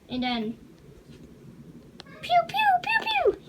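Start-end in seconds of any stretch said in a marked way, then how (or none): 2.2-3.4 very high pew pew laser noises; blaster sound effects. Pretend. Kid making laser noises for the toy blaster.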